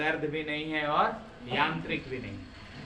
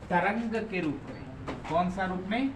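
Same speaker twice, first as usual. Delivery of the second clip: dictation. Acted. Teacher dictating notes slowly.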